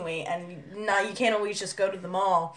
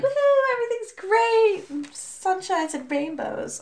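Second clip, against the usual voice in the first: very high, then slower chirpy voice; bubbly Dee Dee; 13 semitones higher, slower than usual. Retold or imitated. Cartoon girl cheering about sunshine, very high and bubbly. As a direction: squeal it like a carefree cartoon girl. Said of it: "she was just like".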